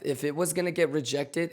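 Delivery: ordinary talk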